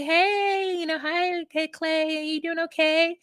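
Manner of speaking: imitated friendly check-in. Woman coming over to check on Clay, imitated friendly check-in. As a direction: act out a friend checking on someone.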